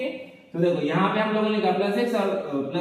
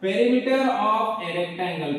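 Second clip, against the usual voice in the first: slow dictation; much slower than usual. Shown as a demonstration. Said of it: "perimeter of a rectangle क्या होता है".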